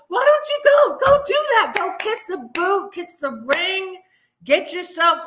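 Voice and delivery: high, loud mocking dare; taunting shout. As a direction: shout it high like a sarcastic challenge. Taunts her brother in imagined quarrel.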